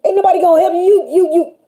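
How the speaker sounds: townsfolk mocking Noah. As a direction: jeer at him like the town gossips. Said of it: "Everybody was like".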